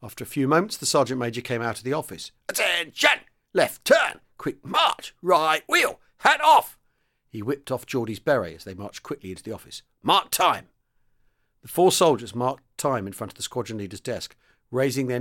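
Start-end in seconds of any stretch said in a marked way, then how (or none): 2.5-6.8 much higher, loud barked drill commands; parade-ground commands. Acted. Sergeant major marching men into the office, loud barked commands. 10.0-10.7 much higher, loud barked drill commands; parade-ground commands. Acted. Sergeant major marching men into the office, loud barked commands.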